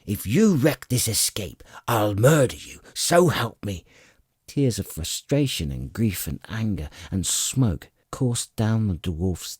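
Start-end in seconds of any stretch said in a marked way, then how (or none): 0.1-3.8 raised, furious dwarf voice; angry threat. A narrator's reading. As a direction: threaten him through clenched fury. Flint threatening the missing kender.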